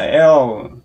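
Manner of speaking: L drawn out slowly, tongue forward; slow L demo. Shown as a demonstration. Teacher modelling the correct L slowly. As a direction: hold the L long. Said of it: "L 해가지고는 절대 안 나와요".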